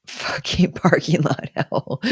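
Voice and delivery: slow, drawn-out words through a laugh; amused, laughing complaint; laughing exasperation. Real, not acted. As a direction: laugh while grumbling it out. Laughing at her own complaint.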